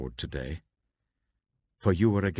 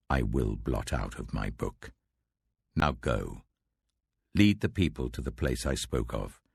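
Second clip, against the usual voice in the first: lower, grave voice of God; voice of the Lord; 6 semitones lower than usual. Acted. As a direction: speak as the Lord, weighty and solemn.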